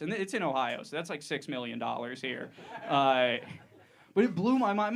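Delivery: ordinary talk, nothing marked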